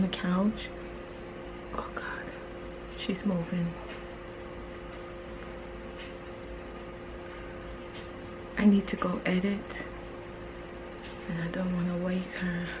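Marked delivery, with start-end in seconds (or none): 1.7-12.7 hushed urgent whisper. Real, not acted. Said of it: "I'm trying to be quiet".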